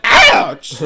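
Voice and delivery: high, loud mock "ouch"; yell of pain. Peter bitten by the spider, exaggerated pained yell. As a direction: yelp it, hammed-up. Spider bites him, acted reaction.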